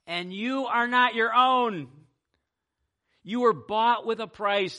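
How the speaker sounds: loud, ringing scripture reading that climbs high; preaching at full force. Acted. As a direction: proclaim the verse like a call from the pulpit.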